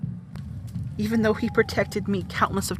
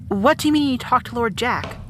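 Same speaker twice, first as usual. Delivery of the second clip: ordinary talk, nothing marked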